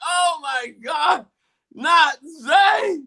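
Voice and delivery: high, slow, loud mock wail; dismayed crowd outcry. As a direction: cry out as if your star just got hurt. Acting out crowd when player hurt.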